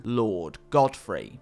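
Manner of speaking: ordinary delivery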